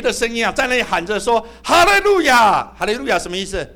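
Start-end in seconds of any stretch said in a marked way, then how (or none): 1.6-3.2 shouted hallelujah. Retold or imitated. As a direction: cry it out like a heavenly crowd. Heavenly crowd shouting.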